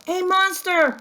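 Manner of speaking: high, loud terrified shout; frightened shout. Acted. Baby seeing a snout at the window, a high loud scared shout. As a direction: scream out in fright. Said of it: "shouted Baby".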